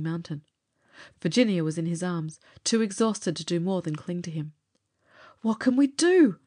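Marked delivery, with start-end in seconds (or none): 5.4-6.4 sputtered out much higher and louder; a loud, high, desperate sputter. Acted. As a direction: spit it out half drowned and panicked. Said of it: "I sputtered".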